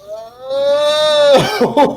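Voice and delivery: long, high, drawn-out "oh"; mock dismay. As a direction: act out baffled alarm, amused. Teller acts baffled reaction, laughing.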